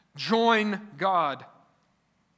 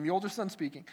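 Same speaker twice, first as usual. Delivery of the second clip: normal talking voice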